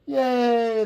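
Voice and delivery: high excited yay; cheering. A narrator's reading. The crowd cheering as Big Bark gets free. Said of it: "the crowd cheered".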